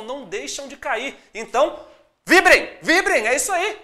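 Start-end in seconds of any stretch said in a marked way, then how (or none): 2.3-3.8 excited cheer. Real, not acted. Teacher cheering students.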